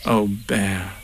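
low, tender murmur; fond Christopher Robin. A narrator's reading. Boy to his bear at story's end, fond. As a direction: say it low and affectionately. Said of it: "said Christopher Robin".

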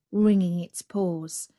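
ordinary delivery

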